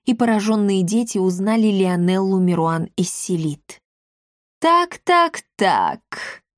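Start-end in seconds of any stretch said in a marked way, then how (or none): 4.6-6.4 gloomy. A narrator's reading. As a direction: start high, then sink grimly on the last word. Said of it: "угрюмо произнесла миледи".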